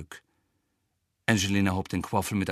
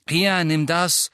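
urging; 6 semitones higher, 4 dB louder, slower than usual. A narrator's reading. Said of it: "forderte Fred sie auf".

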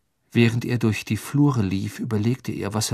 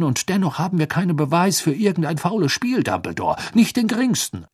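high, loud, fussy official; Fudge's voice; 6 semitones higher, 4 dB louder than usual. Acted. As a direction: bluster like a nervous minister. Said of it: "die Stimme des Zaubereiministers Cornelius Fudge".

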